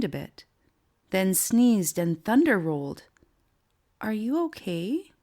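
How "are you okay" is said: put-on gentle little girl's question; gently asked. A narrator's reading. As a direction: ask kindly, leaning in. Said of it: "Hope asked gently".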